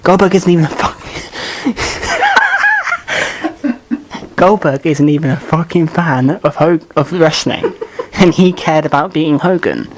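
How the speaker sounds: words broken up by real laughter; laughing helplessly mid-rant; laughing through the words. Real, not acted. Co-host laughing while mocking Goldberg.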